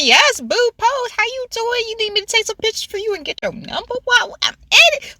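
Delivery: high-pitched flirty cheerleading; retold hyping up.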